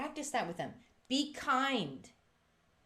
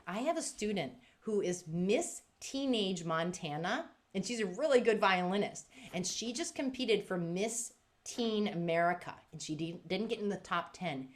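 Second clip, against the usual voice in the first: ordinary delivery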